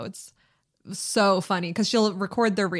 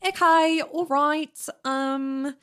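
higher-pitched attempt at Australian; Australian accent; 7 semitones higher, much slower than usual. Shown as a demonstration. Host testing an Australian accent before explaining it, tentative Australian. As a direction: have a playful go at Australian vowels.

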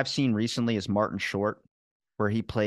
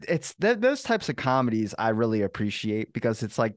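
ordinary delivery

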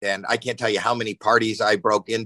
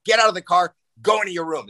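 raised-pitch retold parental command; stern dad order; 7 semitones higher, faster than usual. Dad bringing daughter home, retold stern order. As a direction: act out ordering your kid indoors.

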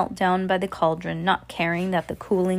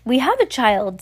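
giddy, higher and louder; excited; 5 semitones higher, 5 dB louder than usual. A narrator's reading. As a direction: blurt it out with glee. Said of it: "Sarah said".

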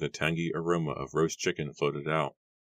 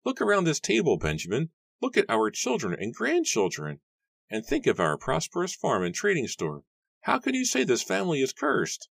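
high, swooping elder's voice; happy; 14 semitones higher than usual. A narrator's reading. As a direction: sing the family's praises in a lifted pitch. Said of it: "Matthew said happily".

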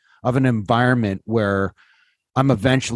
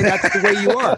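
laughing; 7 semitones higher than usual. Real, not acted. Teasing friend, laughing.

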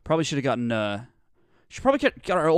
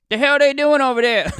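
high, loud bewildered shout; shouted exclaim; 13 semitones higher, 10 dB louder than usual. Retold or imitated. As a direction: yell it across the hollow. Doctor exclaims at blue people.

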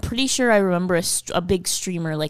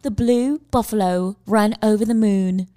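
careful put-on British accent; British accent attempt. Shown as a demonstration. Co-host retries the test phrase for host, British accent. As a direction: try a passable British accent. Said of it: "that's not bad enough".

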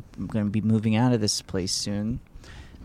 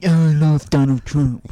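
drawn-out Trump impression; Donald Trump impression; 3 semitones higher, 5 dB louder than usual. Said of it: "when I do an impression of your dad".

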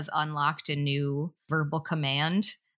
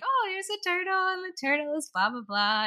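high-pitched mock picture-book chatter; parent narrating pictures. Mother improvising a story for her toddler, animated higher voice. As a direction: lightly sing out in a much higher voice.

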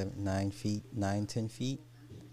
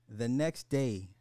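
ordinary talk

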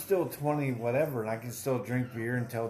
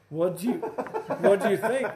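surprised ask; 7 semitones higher, 4 dB louder, slower than usual. Retold or imitated. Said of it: "I was like".

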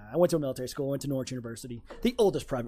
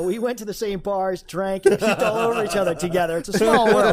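excited; 4 semitones higher, 6 dB louder than usual. Real, not acted. Laughing recollection of college bars.

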